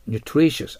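"nutritious" with the middle syllable TRI stressed; accent demonstration. Shown as a demonstration. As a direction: raise pitch and punch TRI in "nutritious". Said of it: "アクセントに気をつけてください". (第二音節を強く高く「nutritious」)

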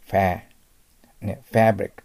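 American English ファブリック with a strong "fa" as in cat; アメリカ英語の強いA. Shown as a demonstration. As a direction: stretch ファー with a forceful American short a. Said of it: "強く発音します". (アメリカ英語式に「ファ」を強く)

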